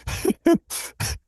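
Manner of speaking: high silent laugh; cracking up before he can speak; burst of laughter. Real, not acted. Narrator recalls finding the feces pipe, high silent laugh.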